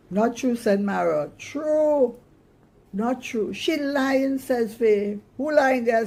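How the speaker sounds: put-on high, loud girlish squabble; squabbling. The girls bicker over who broke the tree, high and loud. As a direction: bicker like sisters.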